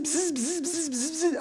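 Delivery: imitated drill buzz. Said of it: "just went bzz".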